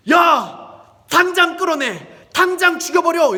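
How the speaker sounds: very loud, high shouted command; furious royal outburst at full volume; enraged king. Acted. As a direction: roar orders in acted fury. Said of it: "왕이 그걸 보고 화가 단단히 났습니다".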